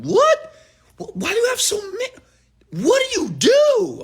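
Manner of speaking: high, loud, disbelieving squeal; mock someone stunned by the cars; shocked admirer. People hearing about all his cars, shrill shocked disbelief. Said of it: "and you're like".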